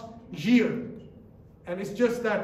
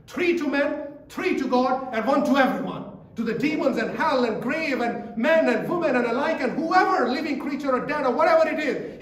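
rising, louder sermon build; preaching at full force; 4 semitones higher, 5 dB louder than usual. Acted. Preacher building to the climax of the sermon. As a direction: drive the point home to the congregation.

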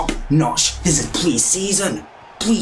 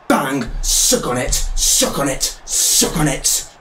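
ordinary delivery

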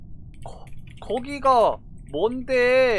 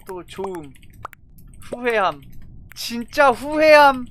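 ordinary talk, nothing marked

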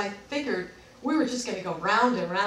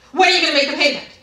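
loud, higher-pitched demand; demanding imitation; 5 semitones higher, 12 dB louder, much faster than usual. Rep pressing caller for payment, demanding. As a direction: mimic a pushy rep cutting in.

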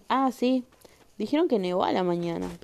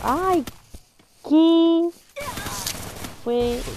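higher, louder startled ay, what was that; 10 semitones higher, 8 dB louder, slower than usual. Real, not acted. Streamer startled mid-game, loud surprised question. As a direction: jump at a sudden noise and ask what it was.

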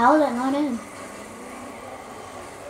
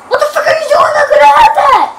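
high, very loud outraged yelling; real rage, pitch shooting up; furious screaming; 9 semitones higher, 9 dB louder than usual. Real, not acted. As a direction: scream at a useless teammate. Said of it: "I'm so mad right now".

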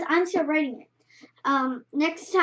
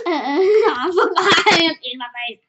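laughing so hard it sounds like crying; helpless laughter; 3 semitones higher, 8 dB louder, slower than usual. Real, not acted. Laughs helplessly at own misreading.

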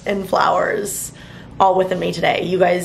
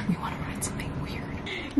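soft unvoiced whisper; 2 semitones higher, 11 dB quieter than usual. Real, not acted. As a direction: breathe it out in a whisper. Whispering at the mirror.

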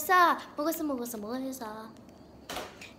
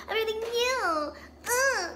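higher brag; 7 semitones higher than usual. Acted. Rival repeating her boast in a higher voice. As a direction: pitch it up, a higher, bratty boast.

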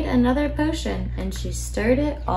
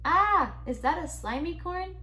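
higher, startled storybook cry; mock alarmed yell; 7 semitones higher, 6 dB quieter than usual. A narrator's reading. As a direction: recoil with a high little 'ah'. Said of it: "they'd fly away and yell".

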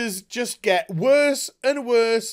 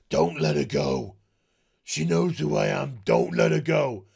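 a lower, lightly voiced Kate; mock Kate; 6 semitones lower than usual. Kate ordering Mary, a hint of low sternness. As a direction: play her as stern but understated.

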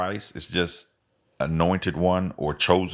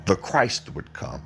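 ordinary talk

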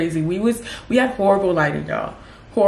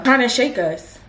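normal talking voice

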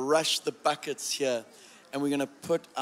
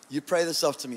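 normal talking voice

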